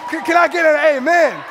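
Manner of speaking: loud, high preacher call; call for amen. Real, not acted. Preacher calling the congregation for an amen, loud and high. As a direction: rouse the church to answer.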